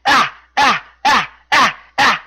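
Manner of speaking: series of short, loud, high "Ah!" yelps; Tozawa's repeated yell. Retold or imitated. Wrestler yells at retreating champion, repeated short mock yells. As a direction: punch out five separate cries in imitation. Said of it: "Tozawa yells".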